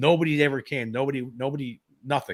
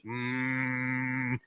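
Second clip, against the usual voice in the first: imitating an eerie droning noise; imitated hum; 7 dB quieter than usual. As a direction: drone a sustained "mmm" on one note. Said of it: "that humming sound was very overpowering".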